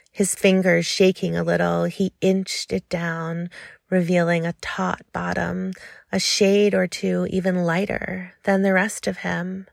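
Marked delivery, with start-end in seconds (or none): none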